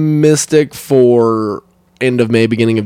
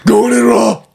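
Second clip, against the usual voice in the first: hammed-up slow growl, raised in pitch; 8 semitones higher, 3 dB louder, slower than usual. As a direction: parody a movie hero's growl. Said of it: "That's how he has sex".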